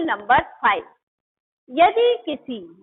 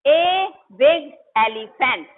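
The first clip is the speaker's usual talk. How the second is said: a big elephant drawn out very slowly and a bit louder; slow clear example; 4 dB louder, much slower than usual. Shown as a demonstration. Teacher dictating an example to students, slow and clear. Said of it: "अब इसमें देखिए".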